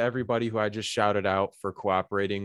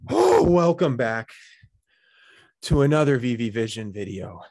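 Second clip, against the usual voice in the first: hyped intro; 4 semitones higher, 7 dB louder than usual. Acted. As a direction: open the video with loud hype. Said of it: "that intro".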